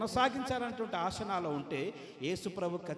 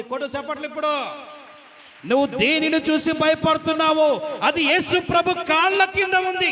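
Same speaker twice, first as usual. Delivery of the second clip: loud, high preacher's roar; preaching at full force; 9 semitones higher, 16 dB louder than usual. Acted. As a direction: hammer it home to the whole hall. Preacher roars to congregation.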